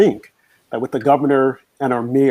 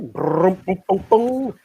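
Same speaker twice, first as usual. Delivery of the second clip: vocal drum roll; 6 semitones higher, slower than usual. Shown as a demonstration. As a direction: mouth drum roll, sung much higher. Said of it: "drum roll".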